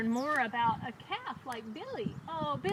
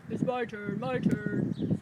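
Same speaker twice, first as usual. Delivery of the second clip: orange cow voice; 3 semitones lower, slower than usual. A narrator's reading. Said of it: "says the orange cow".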